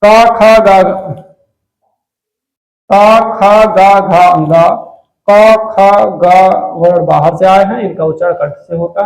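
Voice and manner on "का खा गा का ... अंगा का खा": का, खा, गा, घा, अंगा said loud and separate; loud syllable demo. Shown as a demonstration. Teacher showing throat sounds, loud and clipped. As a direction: bark out each syllable. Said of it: "अब जैसे आप देखोगे".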